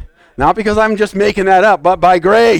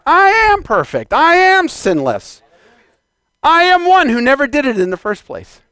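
bold declaration; 8 semitones higher than usual. Shown as a demonstration. Preacher lining thoughts up with God's Word, bold declaring. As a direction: declare it with raised, ringing conviction.

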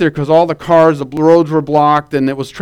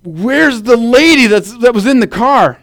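retold yelling; 6 semitones higher than usual. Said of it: "he started yelling to the police".

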